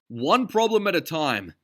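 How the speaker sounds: Ducky roaring at Derville. A narrator's reading. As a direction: roar it over the fight. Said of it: "Ducky roared".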